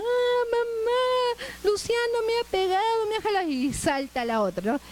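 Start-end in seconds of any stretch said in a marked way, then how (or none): 0.0-3.5 put-on crying child. Child crying to mom about Luciano, high whiny wail. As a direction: play the kid running to mama in tears. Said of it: "viene uno llorando".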